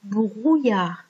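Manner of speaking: "brouillard" very slow, each syllable stretched; pronunciation model. Shown as a demonstration. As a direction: show learners how to say "brouillard", slowly. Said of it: "C'est comme ça que ça se prononce". (« brouillard » prononcé très lentement pour montrer)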